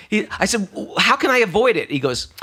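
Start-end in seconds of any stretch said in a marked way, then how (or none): 0.7-1.9 high, urgent retold question; alarmed plea. Monk asking palm reader how to escape fate, alarmed. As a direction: plead for a way out, pitch leaping up.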